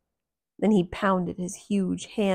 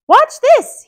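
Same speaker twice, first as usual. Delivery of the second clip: Mickey voice; 17 dB louder than usual. Acted. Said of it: "he said to the giant".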